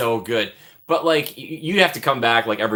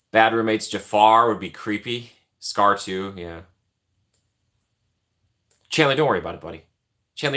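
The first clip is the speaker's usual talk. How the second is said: normal talking voice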